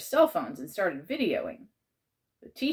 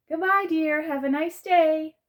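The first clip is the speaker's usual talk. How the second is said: high, sweet call, slower at the end; aunt calling back; 11 semitones higher, 6 dB louder than usual. Acted. Aunt calls goodbye to the boy, high and sweet. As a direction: sing it out like a fond aunt.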